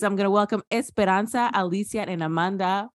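ordinary delivery

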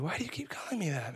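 usual speaking voice

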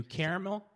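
loud, high "KAIR-uh-mel" for caramel, lifted at the end; questioning pronunciation option. Shown as a demonstration. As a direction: offer caramel starting with "care". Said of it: "How many syllables?".

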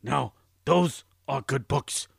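gruff, dopey cookie-loving monster. Acted. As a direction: growl it like a hungry puppet monster. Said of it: "His name is Cookie Monster".

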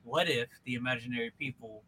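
a hint of a low, hushed filmmaker idea; a lightly voiced Shyamalan pitch. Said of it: "It's like".

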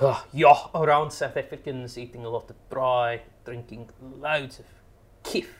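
hammed-up South African accent. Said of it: "me mangling your beau-, it's a beautiful accent".

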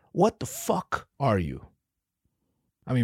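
ordinary talk, nothing marked

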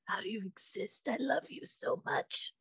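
soft breathy whisper to her cat; adoring whisper. Retold or imitated. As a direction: whisper it like a secret love confession. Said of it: "I'm like".

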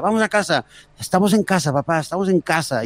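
a hint of excited young daughters; daughters pleading. Daughters in the tree telling dad, lightly voiced childlike. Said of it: "y le decía".

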